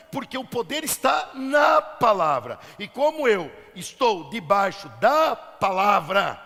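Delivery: projected sermon with stretched, punched key words; forceful preaching. Real, not acted. Preacher declaring the power of the word, forceful preaching. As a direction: build with full conviction.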